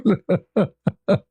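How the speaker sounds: a high, helpless burst of laughter. Real, not acted. Laughing at the stunned man in the elevator, high helpless laugh. Laughing at his own story.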